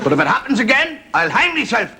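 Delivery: high-pitched comic threat; mock vow. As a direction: swear it with mock determination, high up. Husband repeats his vow in joke.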